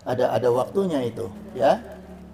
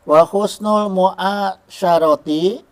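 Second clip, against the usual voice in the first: sangat pelan, keras, tiap suku kata ditahan; slow Arabic recitation; 4 semitones higher, 7 dB louder, much slower than usual. Shown as a demonstration. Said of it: "reciting Arabic text slowly". (bacaan kitab yang sangat lambat)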